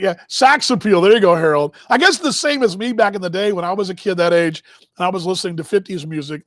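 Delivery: ordinary talk, nothing marked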